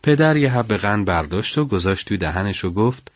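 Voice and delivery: ordinary delivery, nothing marked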